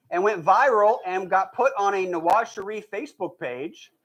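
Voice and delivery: ordinary delivery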